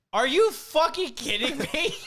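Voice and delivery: high, loud, slow outraged yell; outraged disbelief. Retold or imitated. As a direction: belt out the disbelief. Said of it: "And he just goes".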